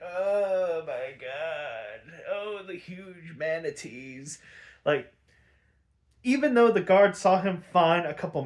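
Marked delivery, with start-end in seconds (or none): 0.0-4.4 slow, very soft, drawn-out feeble moaning; mock-dying Joker, soft and drawn out; pathetic Joker whine. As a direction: ham up a sickly weakling faking illness. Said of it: "he's acting all pathetic".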